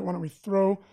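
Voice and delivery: usual speaking voice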